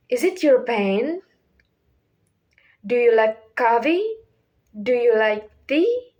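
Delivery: questions climbing at the end, on PAIN, COFFEE and TEA; rising intonation. Shown as a demonstration. Teacher giving practice questions, voice rising on PAIN, COFFEE, TEA. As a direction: start low and lift up on PAIN, COFFEE, TEA. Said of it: "start lower and lift your voice up".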